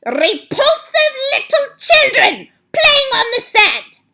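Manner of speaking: very high, loud, furious screech; the Grand High Witch rages at her coven over the children; screaming witch. Acted. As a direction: shriek with disgust at the children. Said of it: "she screamed".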